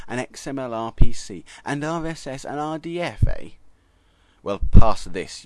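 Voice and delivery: loud, gloating, stomping taunt; gleeful vindictive taunt. A narrator's reading. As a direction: gloat while stomping him down. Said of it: "mumble ... with complete and utter glee".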